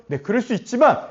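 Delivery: ordinary talk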